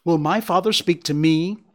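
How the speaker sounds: put-on a small child's indignant demand; cross child. A narrator's reading. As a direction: ask it crossly, like an impatient little one. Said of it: "Bambi became cross and asked".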